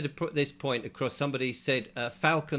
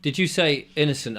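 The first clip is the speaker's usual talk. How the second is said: ordinary talk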